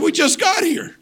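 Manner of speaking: mock astonished protest. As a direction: act amazed you must leave already. Preacher's retold surprised reply.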